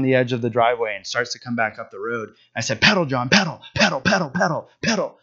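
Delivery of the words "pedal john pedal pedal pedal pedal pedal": higher, urgent repeated urging; urgent cheering. Retold or imitated. Father urging his crying son up the hill, urgent cheering. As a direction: egg him on, word after word.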